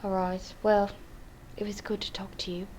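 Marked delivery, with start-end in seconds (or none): 1.6-2.7 soft whispered goodbye; whispering. Real, not acted. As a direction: whisper the farewell. Whispered sign-off.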